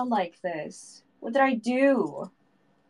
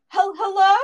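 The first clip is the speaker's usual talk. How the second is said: gasping hello; 9 semitones higher, 7 dB louder than usual. Real, not acted. Gasping after the scare.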